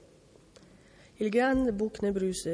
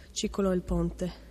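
usual speaking voice

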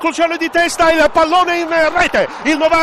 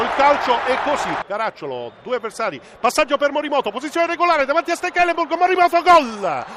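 usual speaking voice

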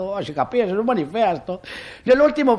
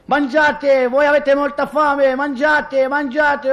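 high-pitched, insistent director's call; raised voice, calling out orders to the set; director shouting; 7 semitones higher, slower than usual. Acted. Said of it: "il regista gridava".

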